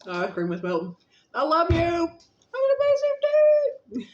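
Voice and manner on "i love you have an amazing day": sing-song goodbye. Real, not acted. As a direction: float it up high like a song. Sing-song farewell to viewers.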